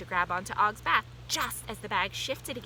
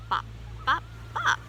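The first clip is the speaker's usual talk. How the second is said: bop sounds; 8 semitones higher than usual. A narrator's reading. New sound heard.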